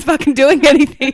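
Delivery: high, loud laughing complaint; mock outrage. Mother recalling idle onlookers during labour, loud laughing outrage. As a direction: blurt it out in amused exasperation.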